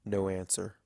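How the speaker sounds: ordinary delivery, nothing marked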